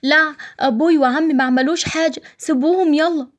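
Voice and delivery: put-on high child's voice begging; a little boy crying out to let his father go; pleading child. Child begs police to free father. (طفل بيتوسل بصوت عالي)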